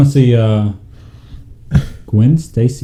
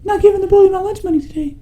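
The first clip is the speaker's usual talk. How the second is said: put-on much higher, fast, whimpering kid voice; whiny bullied kid. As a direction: whine like a kid who just lost his lunch money. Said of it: "That kid looks like".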